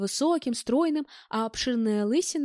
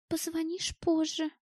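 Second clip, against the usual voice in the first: soft, higher, slow offhand reply; indifferent voice; 5 semitones higher, 5 dB quieter than usual. A narrator's reading. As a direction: answer softly as if you couldn't care less. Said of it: "безразличным голосом ответила девушка".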